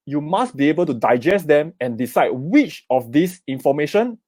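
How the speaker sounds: usual speaking voice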